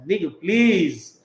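ordinary delivery

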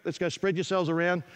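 normal talking voice

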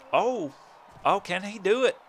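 high, loud eager cheering; root for him, on the edge; excited cheering. Real, not acted. Cheering a fighter on.

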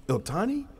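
normal talking voice

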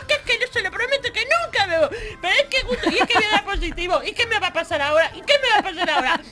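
high, loud desperate pleading; panicked pleading. Retold or imitated. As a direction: beg the officer, voice cracking with panic. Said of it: "y yo". (suplicando al policía, aguda y alarmada)

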